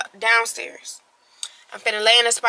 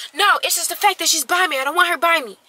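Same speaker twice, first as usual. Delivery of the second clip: high-pitched imitated whining protest; mock fussy insistence; retold petulant objection; 6 semitones higher than usual. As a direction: complain like someone refusing to let it go. Retells his petulant objection.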